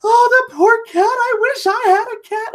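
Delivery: high, mock-wailing child voice; sobbing little boy. Son crying over a cat video, mock high wail. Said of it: "my son is just sobbing".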